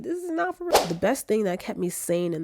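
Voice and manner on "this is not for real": mock British accent, much higher; squeaky hammed-up British. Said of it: "I gotta drop this British accent".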